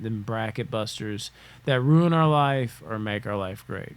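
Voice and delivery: ordinary talk, nothing marked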